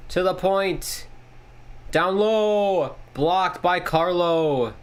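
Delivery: running broadcast call; play-by-play. Acted. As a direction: call the game like a TV announcer.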